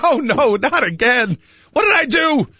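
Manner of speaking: high, hammed-up worry; mock dismay. Acted dismay at police sirens.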